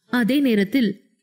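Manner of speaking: plain reading voice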